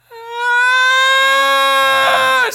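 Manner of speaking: high, loud shriek; long, piercing imitated wail; child's tantrum scream. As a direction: scream like a little girl put in a cart. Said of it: "She's screaming loud".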